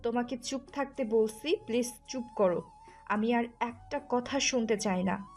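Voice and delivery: fed-up, angry wife; angry silencing. Acted. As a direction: order him to stop talking. Wife angrily silencing her husband.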